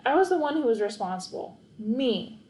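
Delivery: ordinary delivery, nothing marked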